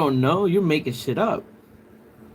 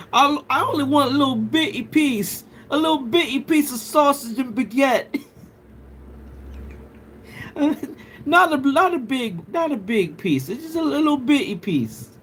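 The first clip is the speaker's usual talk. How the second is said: a put-on posh British accent, pitched up; prim British voice, higher than own; mock posh British; 8 semitones higher than usual. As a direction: ham up a posh English lady. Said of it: "Am I doing it right?".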